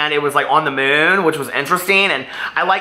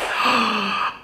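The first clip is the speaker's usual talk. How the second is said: quick, high, soft excited gasp; a real little gasp of excitement; sharp gasp; 6 semitones higher, 5 dB quieter than usual. Real, not acted. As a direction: catch your breath in delight.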